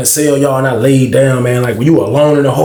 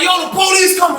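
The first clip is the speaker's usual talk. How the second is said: high warning yell; alarmed shout. Retold or imitated. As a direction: shout the warning across the crowd. Someone warning the crowd, shouting.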